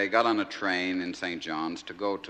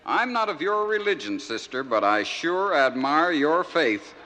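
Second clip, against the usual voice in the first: minister's voice; 5 semitones higher, 5 dB louder than usual. Acted. Minister's punchline to the nun.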